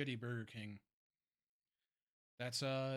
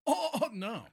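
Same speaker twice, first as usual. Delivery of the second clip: high, loud shocked "oh, no"; shocked outcry; 10 semitones higher, 8 dB louder than usual. Real, not acted. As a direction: gasp it out in disbelief. Shocked he never visited Cane's.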